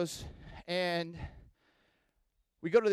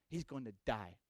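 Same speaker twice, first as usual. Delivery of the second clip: grave; 8 semitones lower, 7 dB quieter than usual. Retold or imitated. Local surfer voiced in story.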